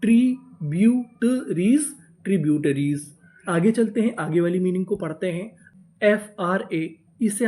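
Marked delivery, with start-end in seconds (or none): 0.0-1.8 syllable by syllable. Shown as a demonstration. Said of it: "पूरी meaning क्या बनी?".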